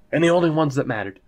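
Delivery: normal talking voice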